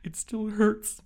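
high whiny voice; whining. Retold or imitated. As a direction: whine it like a child. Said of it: "I'm just like".

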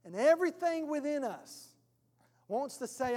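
ordinary talk

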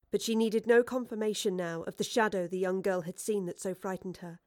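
usual speaking voice

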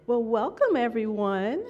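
ordinary talk

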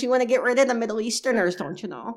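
quick, hammed-up Wisconsin guy; Wisconsin accent. Mock Wisconsin voter ranting to the hosts, fast Wisconsin accent. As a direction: rattle it off in a mock Midwestern accent. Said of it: "like, a shithead Wisconsin guy".

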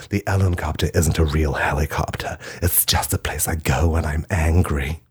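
normal talking voice

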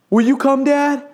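put-on high, small child voice; imitated child's plea; pleading little boy. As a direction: ask like a timid kid wanting company. Small son asks dad along.

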